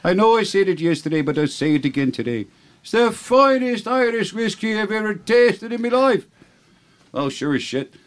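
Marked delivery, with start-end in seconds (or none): 0.0-2.5 higher-pitched Irish wife; hammy Irish brogue, pitched up; horrible Irish accent. Acted. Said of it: "And she goes". 2.8-6.2 very high, slow Irish rapture; drawn-out, over-the-top Irish brogue; horrible Irish accent. Acted. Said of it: "your horrible accent that makes the joke".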